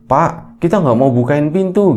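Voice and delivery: lightly acted refusal through the door; child calling from inside. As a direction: call back to father from inside. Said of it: "Itu suara anaknya".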